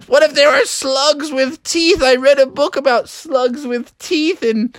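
high, wailing mock dread; hammed-up anxious whining, drawn-out groans; nervous fretting. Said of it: "he was so nervous".

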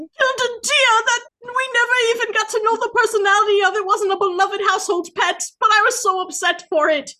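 high put-on mocking voice; hammed-up imitation of a friend. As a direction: squeak out her complaint in mockery. Mimicking friend's complaint.

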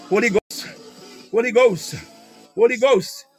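ordinary talk, nothing marked

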